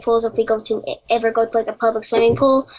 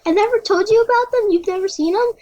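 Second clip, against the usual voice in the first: much higher, incredulous questioning; surprised retold reply; 10 semitones higher than usual. Girl asking her friend about the implants, surprised. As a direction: act out your own disbelief.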